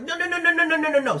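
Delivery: high, loud rapid-fire panic; panicked refusal. Retold or imitated. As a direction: squeal it in a frantic string. Panics as cockroach follows him.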